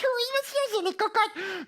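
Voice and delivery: high little puppet voice; insistent puppet. Acted. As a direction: confirm it stubbornly as a puppet. Puppet insists on wrong word.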